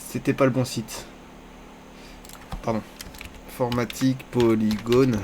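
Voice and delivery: usual speaking voice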